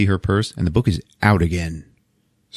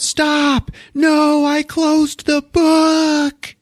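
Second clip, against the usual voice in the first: high-pitched, drawn-out mock scream; 3 dB louder, much slower than usual. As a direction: act out a desperate screamed plea, stretching the end. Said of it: "and she screams out".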